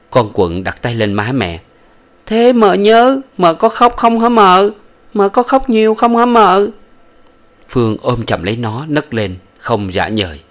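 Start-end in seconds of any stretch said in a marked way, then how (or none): 2.3-6.7 put-on high, louder childlike pleading; little girl voice. Daughter asking her mother if she cried, high child voice. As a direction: ask like a small girl worried for her mother.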